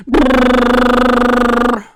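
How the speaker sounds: loud, high sustained trilled ring; piercing rolled brrr; alarm clock. Acted. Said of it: "went the alarm clock".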